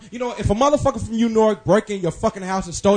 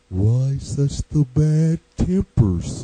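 slow, lower mopey donkey voice; Eeyore; 5 semitones lower, much slower than usual. Shown as a demonstration. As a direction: drag it out like a gloomy donkey. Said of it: "I'm gonna say this like Eeyore".

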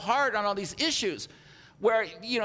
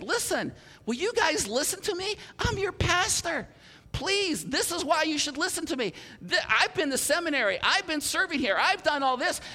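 exasperated pleading; 7 semitones higher than usual. Retold or imitated. Pastor begging his congregation.

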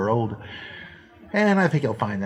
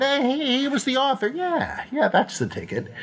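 mock high voice; 13 semitones higher than usual. Mock voice of ancient apologist.

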